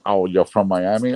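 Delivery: normal talking voice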